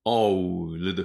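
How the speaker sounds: "オールド" with a drawn-out "ou" and distinct ld; "オールド" slowly exaggerated as English o-u-l-d; exaggerated English "old". Shown as a demonstration. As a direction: overdo "オールド", stretching the glide before l-d. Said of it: "ちょっと大げさにやってますけど".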